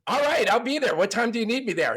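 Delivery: high, keen Biff jumping at the invite; Biff, eager and high-pitched. Acted. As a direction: sell it like he can't wait. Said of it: "And Biff goes".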